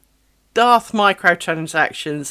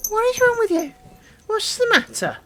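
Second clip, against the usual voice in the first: high, sing-song coo to a pet. Real, not acted. Baby voice to the dog.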